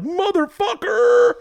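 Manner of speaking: very high, stretched-out exclamation; mock outraged cry. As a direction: squeal it slowly in comic disbelief. Acts out his stunned reaction.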